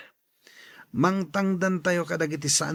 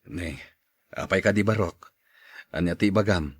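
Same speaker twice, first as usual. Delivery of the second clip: low, deeper put-on man's voice; older man voice; 12 semitones lower, 3 dB quieter than usual. Tata Ben answering the boy in a low voice. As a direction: drop low like an older uncle.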